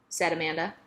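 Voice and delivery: ordinary delivery, nothing marked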